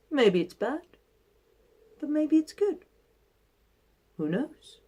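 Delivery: put-on calm, measured old man voice; wise old sage. Old man's calm reply.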